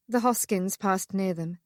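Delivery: ordinary delivery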